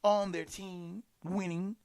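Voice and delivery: normal talking voice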